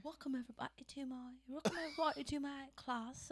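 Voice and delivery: mock posh British lecturer; Oxford professor. Imitating an Oxford professor greeting his class, mock posh British. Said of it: "like, Oxford professor".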